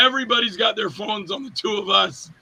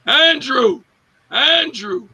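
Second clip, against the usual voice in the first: calling across room; 6 semitones higher, much slower than usual. Retold or imitated. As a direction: shout across a big room to get attention.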